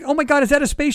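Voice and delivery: high, loud, astonished question; imitated shock. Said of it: "she's actually shocked".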